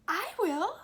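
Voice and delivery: high, loud eager shout; dog calling out for the cake; eager cry. A narrator's reading. Dog shouting to claim the cake, high and loud. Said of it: "cried the dog".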